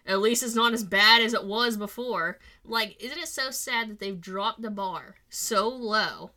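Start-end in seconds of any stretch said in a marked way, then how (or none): 0.0-2.4 sarcastic simp voice; louder, mocking reviewer quote. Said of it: "are like".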